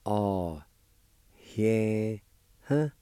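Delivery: Oh, yeah, huh said as one Cherokee word, ah-eh-huh; teacher sounding out Oh, yeah, huh; Cherokee pronunciation. Shown as a demonstration. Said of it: "It's pronounced like this".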